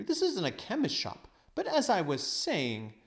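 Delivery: a lightly voiced, brushing-off old man; dismissive old uncle. Uncle Andrew brushing off Diggory's question, impatient. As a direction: wave the boy away impatiently.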